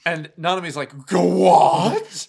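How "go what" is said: loud, drawn-out baffled outburst; acted shock. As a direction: blurt it loudly in exaggerated disbelief. Acted shocked reply to Akio.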